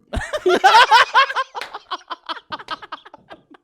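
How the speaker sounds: loud, high burst of laughter; real big belly laugh; laughing hard. Real, not acted. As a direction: crack up helplessly, long.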